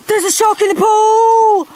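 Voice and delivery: high, loud panicked howl. A narrator's reading. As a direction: howl the alarm. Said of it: "howled rude Ralph".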